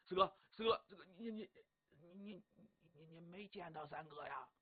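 hushed, halting question. A narrator's reading. Officers asking fourth brother about third brother, hushed and hesitant. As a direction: stammer it softly, fading almost to nothing. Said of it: "还得压低声音说话".

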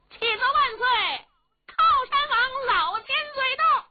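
eunuch's loud announcement. Acted. Head eunuch announces the old prince to the emperor, high ringing cry. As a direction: cry it out across the palace hall. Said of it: "这总管高声喊嚷".

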